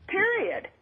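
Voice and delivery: "Period." jumps high and hard; final word. Real, not acted. Caller finishing her rebuttal to the guest, emphatic. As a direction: slam the point shut.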